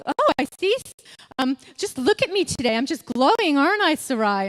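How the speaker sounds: high, loud mock smugness; acted-out cheeky boasting; cheeky gloating. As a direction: show off to a rival, teasing and pleased with yourself. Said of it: "like, cheeky, isn't it?".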